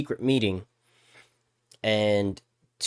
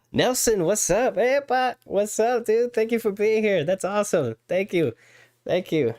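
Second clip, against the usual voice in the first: excited greeting; 10 semitones higher than usual. Real, not acted. Greeting viewers in chat.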